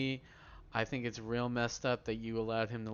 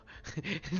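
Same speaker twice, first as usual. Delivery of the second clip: giddy high laugh; 8 semitones higher than usual. Real, not acted. Giddy about hitman quest.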